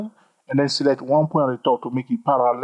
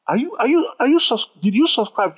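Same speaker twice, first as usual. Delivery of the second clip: sudden high, eager question to viewers; excited call-out; 10 semitones higher than usual. Real, not acted. Teacher breaks off to ask viewers about subscribing, high and eager. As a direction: jump up high to grab the viewers.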